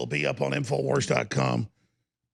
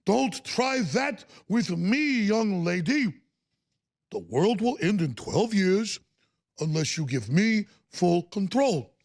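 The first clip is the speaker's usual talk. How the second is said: mock Bernie Sanders; 3 semitones higher than usual. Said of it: "And Sanders is like".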